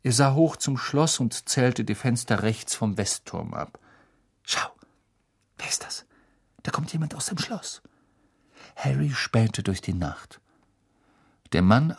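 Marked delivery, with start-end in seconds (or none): 4.4-7.8 soft alarmed whisper; hushed whisper. A narrator's reading. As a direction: whisper urgently while hiding. Hermine spots someone, hiding.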